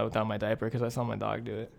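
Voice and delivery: ordinary talk, nothing marked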